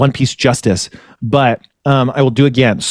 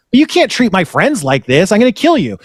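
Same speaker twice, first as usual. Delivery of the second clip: mock threat; 7 semitones higher than usual. Shanks threatening bandits.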